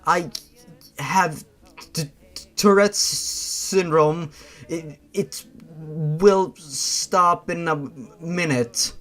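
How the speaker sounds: slow, stammering explanation; stuttering explanation. Acted. Explaining Tourette's to the strangers, slow stammer. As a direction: fight the tic to get it out slowly. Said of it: "between the giggling".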